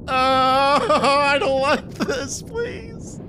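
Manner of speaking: high, slow, half-laughing fearful cry; scared whimper. Real, not acted. Squeezing through a tight gap, begging in real fright. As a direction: plead in real fright, near tears. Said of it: "I'm tearing up, man".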